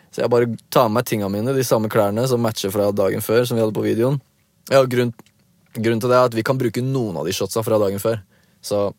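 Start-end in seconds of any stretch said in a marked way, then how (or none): none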